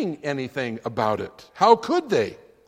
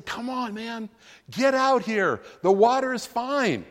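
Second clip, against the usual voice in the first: a light, higher cheerleader call; cheerleader Jesus; 5 semitones higher than usual. Acted. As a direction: urge him out onto the water, lightly voiced. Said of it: "Jesus becomes the cheerleader".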